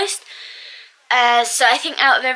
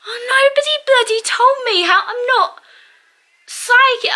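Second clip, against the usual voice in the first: high, indignant complaint; complaining to the chef, much higher, exasperated; exasperated protest; 10 semitones higher than usual. Retold or imitated. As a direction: protest that nobody warned you.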